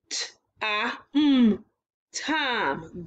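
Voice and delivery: segmented T, ah, mm then blended into Tom; sound blending. Shown as a demonstration. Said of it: "change the mm to t".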